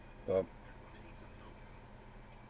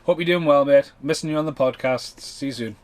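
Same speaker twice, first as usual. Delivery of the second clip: much louder, higher cheery mate greeting; reading friend's voicemail; 4 semitones higher, 9 dB louder than usual. Retold or imitated. Paul Ray's voicemail read aloud to viewers, loud cheery greeting. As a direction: belt it out like a cheerful mate on the phone. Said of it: "I had a message".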